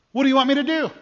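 high, eager, emphatic question; emphatic offer. Acted. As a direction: ask as if offering anything at all. Jesus offers a blank check.

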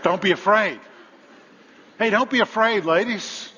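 loud, high angel's call; proclaimed. Acted. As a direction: reassure them with a ringing cry.

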